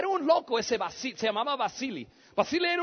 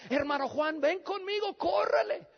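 lightly voiced, higher, pressing call; urgent girl; 6 semitones higher than usual. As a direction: mimic a girl hurrying someone along. Retold girl urgently calling him away.

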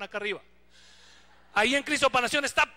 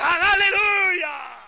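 loud, slow hammed-up aleluya; mock congregation cheer, loud and drawn out; 6 semitones higher, 9 dB louder, much slower than usual. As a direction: stretch out a crowd's aleluya. Imitating congregation.

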